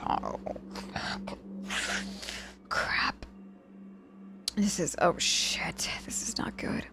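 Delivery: hushed, worried self-talk; whispered panic. Acted. Patient realizing the mess, whispered alarm to herself.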